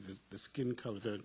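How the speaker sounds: ordinary delivery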